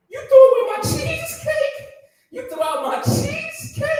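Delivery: high-pitched incredulous squeal; hammed-up high indignation; outraged disbelief. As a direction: squeak it out, appalled at the loss. Acted quarrel over thrown cheesecake.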